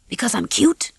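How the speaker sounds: usual speaking voice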